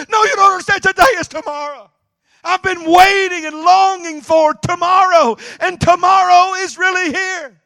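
overjoyed high proclamation; ecstatic. Retold or imitated. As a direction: pour out long-awaited joy. Phil explaining in ecstasy.

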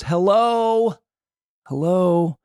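high, pointed interjection; sarcastic calling out. Real, not acted. Host reacting to officers motivated by money, sarcastic calling out. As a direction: call it out as if it were obvious.

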